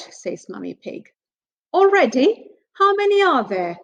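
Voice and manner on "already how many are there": grumbling. Acted. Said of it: "grumbles Daddy Pig".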